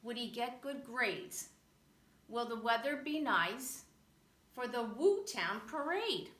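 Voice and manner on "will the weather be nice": usual speaking voice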